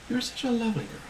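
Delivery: soft, high, motherly murmur; tender motherly voice. Acted. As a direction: say it softly and tenderly, as to a small child. Tender motherly figure strokes hair.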